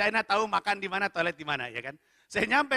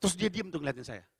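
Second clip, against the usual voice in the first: ordinary talk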